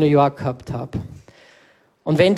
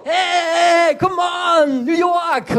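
hyped friend; 12 semitones higher, much slower than usual. Retold or imitated. Friend hyping him up in Times Square, high mock shout. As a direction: yell it like an overexcited buddy. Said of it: "Dann alles so".

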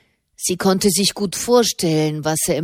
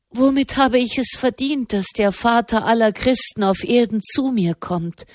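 plain reading voice

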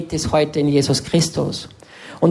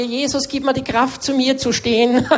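mock timid prayer; 7 semitones higher than usual. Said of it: "ganz schüchtern hingegangen".

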